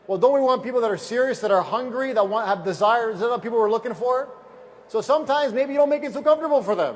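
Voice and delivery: rally the crowd like a preacher; preaching to the room. Acted. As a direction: seminar speaker pressing the audience, loud and rousing. Louder seminar exhortation.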